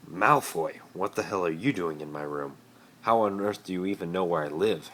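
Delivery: loud, startled demand; confused Harry. Acted. Harry confronting Malfoy in his room, loud and startled. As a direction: catch an intruder in your room. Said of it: "Harry asked".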